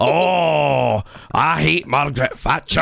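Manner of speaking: high-pitched mock Scots; loud, overdone fake Scottish; terrible Scottish accent. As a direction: parody a bad Scottish impersonator. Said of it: "their terrible Scottish accents".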